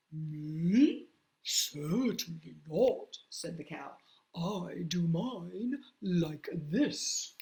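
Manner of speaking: low, slow, prim cow. Acted. Cow answers.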